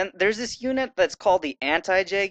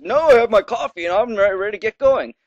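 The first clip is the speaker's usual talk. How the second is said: lightly voiced game character; reading NPC lines; 6 semitones higher, 6 dB louder than usual. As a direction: read it as a chatty NPC. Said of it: "This guy says".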